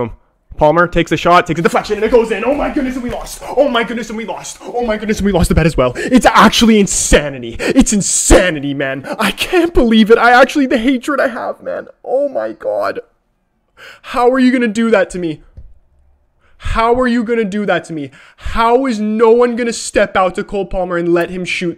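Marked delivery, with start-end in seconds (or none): 0.6-2.4 live call of the late winner, rising in shock; rising disbelief. Real, not acted. Calling the winning goal live. 2.4-20.4 high, loud outraged wailing; furious disbelief. Real, not acted. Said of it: "I'm so mad right now".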